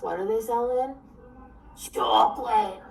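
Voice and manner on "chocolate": put-on Long Island accent. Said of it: "Long Island vocabulary".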